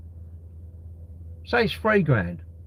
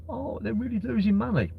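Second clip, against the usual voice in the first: mock pity; 8 semitones higher, 4 dB quieter than usual. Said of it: "we're, like, crying for 'em".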